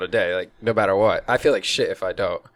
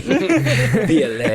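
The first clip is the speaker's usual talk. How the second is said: put-on very slow, laughing seductive French accent; drawn-out laughing French accent imitation; mock seductive French; 2 semitones higher, much slower than usual. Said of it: "That sounds more seducing".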